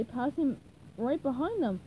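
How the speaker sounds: ordinary delivery, nothing marked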